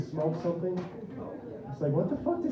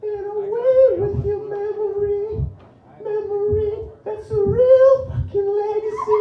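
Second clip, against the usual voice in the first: high, slow, sung and wailing pop-star voice; 6 dB louder, slower than usual. Retold or imitated. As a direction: croon it high and slow like a weepy pop ballad. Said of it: "I apologize for my [name] impression".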